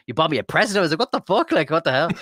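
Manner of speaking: high, loud disbelief with a laugh; overwhelmed by a friend's gift; astonished. Retold or imitated. Streamer retelling a surprise present, astonished laugh.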